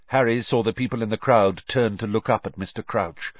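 ordinary delivery, nothing marked

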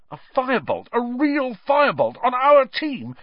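high, rising awe-struck exclamation; thrilled. A narrator's reading. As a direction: build from wonder to loud, high excitement. Wood thrilled about the broom.

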